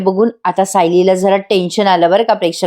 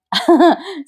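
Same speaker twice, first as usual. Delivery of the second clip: short laugh; 7 semitones higher than usual. Real, not acted. Laughs after Chaitanya's line.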